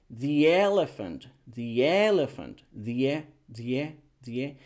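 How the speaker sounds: "the elephant" slowly as thee-yelephant; diphthong link. Shown as a demonstration. Teacher drills the diphthong, linked thee. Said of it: "creamos este diptongo".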